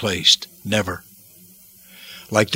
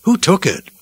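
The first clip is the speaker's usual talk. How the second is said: pitched up on the repeat, demanding an answer; urgent demand; 7 semitones higher than usual. Acted. Women at the tomb question who took the body, demanding. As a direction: demand to know, rising in urgency. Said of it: "they demanded to know".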